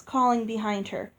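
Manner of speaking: ordinary delivery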